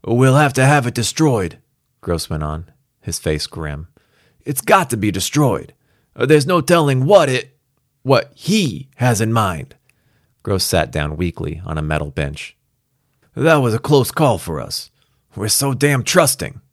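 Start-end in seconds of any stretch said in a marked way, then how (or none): 0.1-1.6 put-on New York cop accent, grim. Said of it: "New York accent or something". 4.5-9.8 put-on New York cop accent, shaken. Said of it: "New York accent or something". 13.3-16.6 put-on weary New York cop accent; rueful New York brute. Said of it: "New York accent or something".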